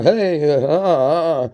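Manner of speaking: "Hey" pitched high and loud, trailed by uhs; "Hey" as a high mock call, then stammered uhs; exaggerated hey. Shown as a demonstration. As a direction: overreact to a loaded "Hey". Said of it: "I know the way that you say".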